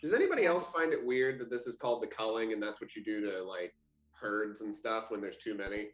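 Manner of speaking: ordinary delivery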